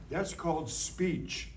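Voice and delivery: ordinary talk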